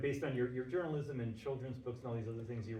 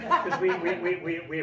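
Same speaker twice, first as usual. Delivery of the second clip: laughing; 6 semitones higher, 11 dB louder than usual. Real, not acted. Stumbling, laughing over others.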